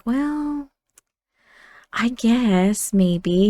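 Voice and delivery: drawn-out, hesitant giving in; reluctant agreement. Acted. Brother answering his sister about the show, reluctant agreement. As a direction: drag it out, unsure.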